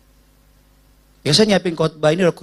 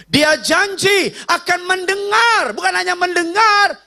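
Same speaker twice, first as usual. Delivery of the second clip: full-force preaching; 12 semitones higher than usual. Acted.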